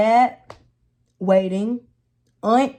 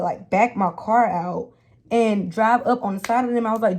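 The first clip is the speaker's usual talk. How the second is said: ordinary talk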